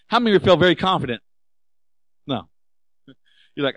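normal talking voice

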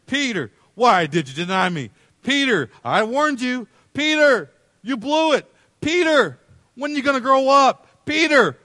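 loud, high, exasperated rebuke; mock scolding. Imagining Jesus berating Peter for denying him, scolding. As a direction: tell him off, voice climbing on each name.

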